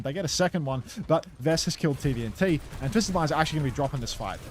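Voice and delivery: lively play-by-play, rising with the action; esports caster. Acted. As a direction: call the match like a live caster. Casting the match live.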